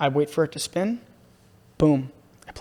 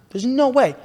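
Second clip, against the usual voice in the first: disbelief; 9 semitones higher, 4 dB louder, slower than usual. Retold or imitated. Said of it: "I go".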